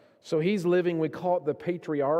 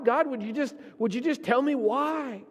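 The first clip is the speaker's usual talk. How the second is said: pleading cry; 11 semitones higher than usual. Retold or imitated. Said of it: "He's been crying out to God".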